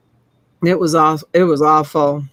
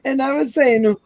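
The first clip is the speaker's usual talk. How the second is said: ordinary delivery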